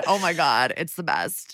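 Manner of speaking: ordinary talk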